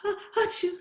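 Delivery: demonstrated pretend sneeze; fake sneeze. Shown as a demonstration. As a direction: fake a sneeze with a catch of breath first. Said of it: "Like you're gonna sneeze".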